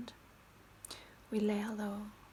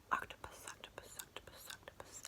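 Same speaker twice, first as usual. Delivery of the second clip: each angelfish softer than the last; fading whispered echo; 10 dB quieter than usual. Pretend. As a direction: breathe the word away three times.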